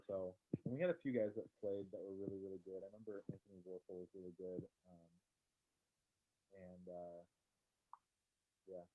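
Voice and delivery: muffled, hand over the mic; covering the mic. Real, not acted. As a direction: voice dulled behind a covered mic. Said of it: "I think you're covering your mic again".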